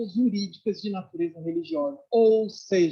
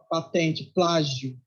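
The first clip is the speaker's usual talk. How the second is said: ordinary talk, nothing marked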